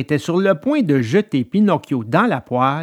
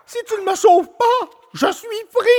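put-on much higher, pleading little wooden-boy voice; small feeble puppet voice. A narrator's reading. Pinocchio trapped in the flour begging the dog, feeble high plea. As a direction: cry out small and helpless for rescue. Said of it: "une petite voix toute faible qui disait".

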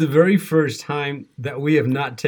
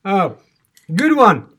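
excited yelp; 6 semitones higher, 8 dB louder, slower than usual. Real, not acted. Reacting to fiery chip.

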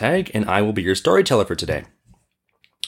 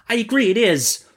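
high-pitched meek merchant; merchant's reply; 11 semitones higher than usual. Acted. Merchant concedes to the genie, high meek voice. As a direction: concede in a much higher voice. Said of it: "replied the merchant".

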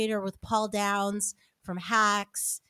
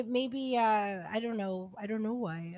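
ordinary delivery

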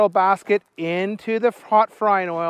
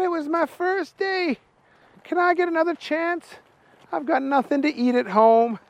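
pleading Booger; 6 semitones higher than usual. Acted. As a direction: beg for a second chance. Booger pleads for his job.

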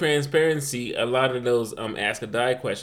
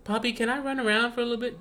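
put-on little boy asking; 10 semitones higher, faster than usual. Son asks his dad.

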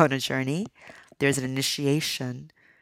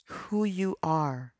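drawn out, each word weighted; slow it right down and savour it; slow emphatic repeat; much slower than usual. Real, not acted.

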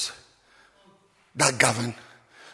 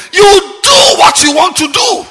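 preaching shout; 6 semitones higher than usual. Real, not acted. Pastor shouting at lawless church members. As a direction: thunder it at the congregation.

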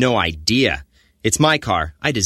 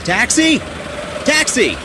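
calling out; 12 semitones higher, slower than usual. Acted. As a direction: shout for a taxi, higher. Hailing a cab.

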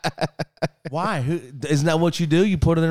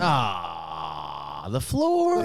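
mock horror; 7 semitones lower, 3 dB quieter, much slower than usual. Real, not acted.

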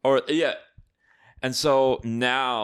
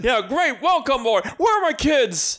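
high, hammed-up elation; pitched-up joyful movie hero imitation; giddy George; 10 semitones higher, 4 dB louder than usual. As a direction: welcome trouble gladly, then call for the kids. Acting out George's giddy return home.

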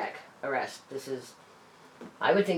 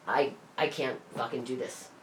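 soft, drained and low; defeated; 5 semitones lower, 8 dB quieter than usual. Retold or imitated. Telling her boyfriend she is done, quiet and defeated. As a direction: give up, worn out. Giving up and leaving.

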